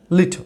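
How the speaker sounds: "লিটল" with a weak, swallowed last syllable; স্বাভাবিক ইংরেজি উচ্চারণ. Shown as a demonstration. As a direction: say "লিটল" short, almost no vowel before the final l. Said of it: "দেখেন আমি কি বলছি". ("লিটল"-এর শেষ syllable দুর্বল করে বলা)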